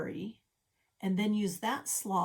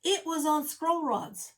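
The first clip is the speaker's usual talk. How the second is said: lightly voiced viewer objecting, higher; imagined viewer; 7 semitones higher, 5 dB louder than usual. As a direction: play a surprised viewer catching her out. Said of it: "And you're saying".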